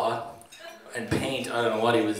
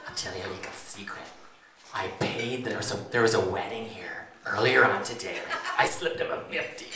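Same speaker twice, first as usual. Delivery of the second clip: hushed, half-whispered confidence with a laugh; 1 conspiratorial whisper; 3 semitones lower than usual. Retold or imitated. Best man mimics the bride whispering her secret, conspiratorial. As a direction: let her secret slip out under the breath.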